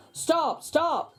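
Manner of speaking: calling out to halt them; shouted. A narrator's reading. Morty shouting after the baby carriage. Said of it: "shouted Morty".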